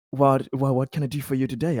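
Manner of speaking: usual speaking voice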